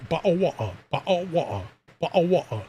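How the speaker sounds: tuning up a mock British accent. Shown as a demonstration. American warming up a British accent for a friend, mock British accent. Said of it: "let me tune up a bit".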